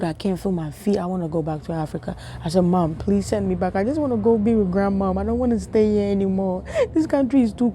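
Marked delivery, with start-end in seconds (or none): none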